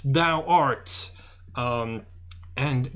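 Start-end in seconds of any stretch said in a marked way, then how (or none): 0.0-1.1 lightly voiced archaic knight; a hint of an archaic speech; old-timey voice. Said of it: "doing the old-timey voice".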